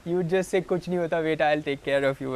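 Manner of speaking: put-on high pleading devotee with a Pakistani accent. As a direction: whine it like a Pakistani disciple begging his guru. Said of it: "This is how we are".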